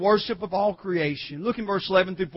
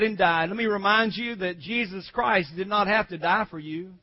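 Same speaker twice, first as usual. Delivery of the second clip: ordinary talk, nothing marked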